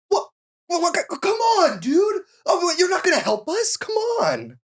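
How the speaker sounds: very high hammed-up teen pleading; bad acting. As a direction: overact a desperate kid shouting. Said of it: "It's pretty good, right?".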